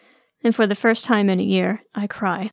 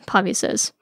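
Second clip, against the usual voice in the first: plain reading voice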